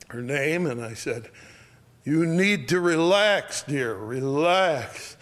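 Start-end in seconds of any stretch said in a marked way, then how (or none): 2.0-5.2 slow, drawn-out, raised voice; loud, slow reassurance. Retold or imitated. To a gasping dying woman, loud and slow. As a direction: project it slowly to someone barely conscious. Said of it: "I said it loudly".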